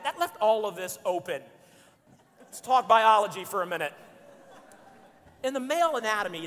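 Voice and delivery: usual speaking voice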